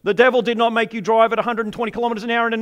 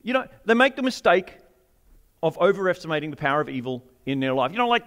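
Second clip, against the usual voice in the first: ordinary delivery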